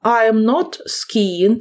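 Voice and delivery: slow, careful example for pupils; slow model sentence. Shown as a demonstration. Teacher models a negative sentence for the class, slow and clear. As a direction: lay each word out slowly. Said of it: "це буде виглядати так".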